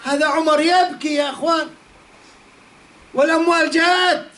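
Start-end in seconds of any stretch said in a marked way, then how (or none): none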